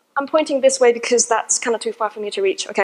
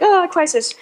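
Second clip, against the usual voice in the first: mock crisis; 6 semitones higher than usual. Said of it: "going like".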